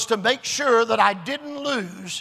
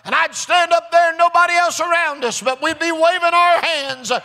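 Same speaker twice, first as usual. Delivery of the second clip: high, driving preacher delivery building to a shout; rousing preaching; 7 semitones higher, 5 dB louder than usual. Real, not acted. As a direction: build the testimony into a shouted, triumphant promise. Preaches at full force.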